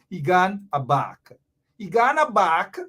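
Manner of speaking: slow, drawn-out Creole "He gone back"; Guyanese Creole, said slowly. Shown as a demonstration. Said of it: "Repeat after me".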